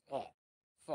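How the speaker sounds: "স" said from inside the throat. Shown as a demonstration. Teacher demonstrating pinyin CH to learners, "স" from the throat. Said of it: "এটা গলার ভিতর থেকে উচ্চারণ হবে".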